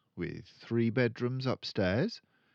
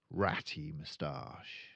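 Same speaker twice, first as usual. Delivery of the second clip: plain reading voice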